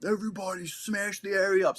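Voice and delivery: high-pitched mock yell of a command; imitated screaming order. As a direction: bellow it like a sergeant, held back in volume. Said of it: "my platoon sergeant screaming".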